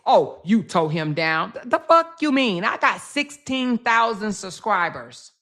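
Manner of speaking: ordinary talk, nothing marked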